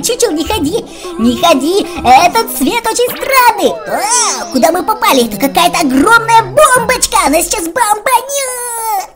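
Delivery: normal talking voice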